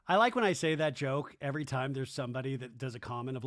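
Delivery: ordinary talk, nothing marked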